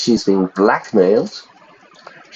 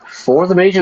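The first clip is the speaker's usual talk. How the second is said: rising, puzzled For the Major, higher pitched; puzzled question; 8 semitones higher than usual. Real, not acted. Streamer puzzled by a typo, reading it as a question. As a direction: query the odd wording of For the Major. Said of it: "That should be from the Major".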